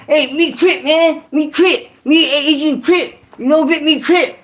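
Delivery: put-on high, slow broken-English Vietnamese accent; imitating a Vietnamese Crip, pitched high and slow; mock Vietnamese accent. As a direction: boast in a hammed-up Vietnamese accent. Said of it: "They're like".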